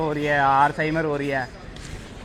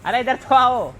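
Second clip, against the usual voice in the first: calling out again; 9 semitones higher, 3 dB louder, slower than usual. Real, not acted.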